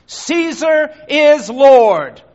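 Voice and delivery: loud, slow, drawn-out declaration; defiant proclamation. Acted. Unbeliever at the altar, slow loud proclamation. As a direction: proclaim it slowly and loudly to the crowd.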